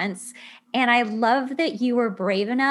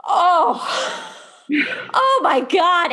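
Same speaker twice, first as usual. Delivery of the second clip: high, loud gasp of emotion; overwhelmed, exclaim it up high; louder, rising cry of being moved; touched exclamation; 7 semitones higher, 5 dB louder, slower than usual. Real, not acted. Moved by the daughter's story.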